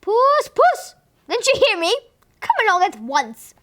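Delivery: high, loud impatient call. A narrator's reading. Husband cold at the door, impatiently calling the cat loudly. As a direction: call sharply, losing patience. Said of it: "rather impatiently".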